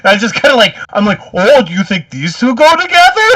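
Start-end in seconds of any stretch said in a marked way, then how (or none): 1.3-3.3 high, mock-helpful sarcastic question. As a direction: ask it with exaggerated fake cluelessness, pitched way up. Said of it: "Oh, he's doing it sarcastically".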